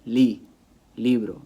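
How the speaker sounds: LI punched first, then "libro" with weight on LI; stress on LI. Shown as a demonstration. Teacher shows Spanish learners where stress goes, stress on LI. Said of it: "stress is gonna have to go on the second to last".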